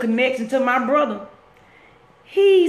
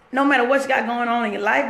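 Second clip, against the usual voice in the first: usual speaking voice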